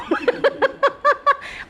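shrieking high giggle; high cackling laugh. Real, not acted. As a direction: burst into a squealing laugh. Teacher laughing at own impression.

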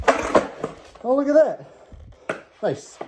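thrilled whoop, stretched slowly; excited cheer. Real, not acted. Lands a skateboard trick on camera.

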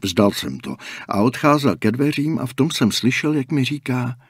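ordinary delivery

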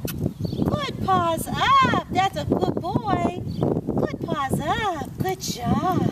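drawn-out praise. Real, not acted. Trainer praising the dog's paws up slowly. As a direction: draw each word out long.

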